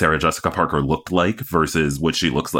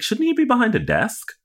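pitched way up in disbelief; mock incredulous. Podcaster mocking the doctor's casual manner, high incredulous squeak. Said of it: "I was like".

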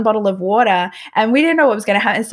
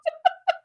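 high short laugh; 6 dB quieter than usual. Real, not acted. Laughing after retold crying.

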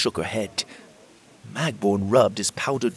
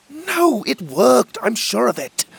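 insistent protest; 6 dB louder than usual. Acted. Will insists spell worked.

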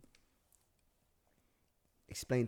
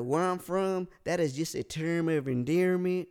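put-on Southern accent; 3 semitones higher, 4 dB louder than usual. Customer explaining himself to the clerk, Southern accent. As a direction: explain yourself as a polite Southerner. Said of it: "Southern accent, but I, I do accents".

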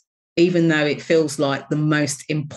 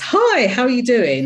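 bright put-on cheerful greeting, higher and louder; brave face cheer; 6 semitones higher, 3 dB louder than usual. Answering a caller with a forced brave face. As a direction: fake it cheerful on the phone. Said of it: "put on the brave face".

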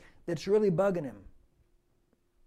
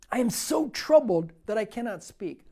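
forceful scripture reading; 2 semitones higher, 4 dB louder than usual. Acted. Preacher reading Psalm seventy-seven aloud to the church, loud and declaiming. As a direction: declaim it to the congregation.

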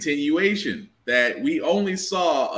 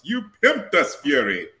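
mock English accent; 3 semitones lower, slower than usual. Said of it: "the English accent killed me".

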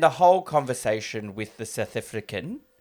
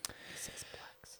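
whisper; 25 dB quieter, slower than usual. Real, not acted. Can't say the word aloud.

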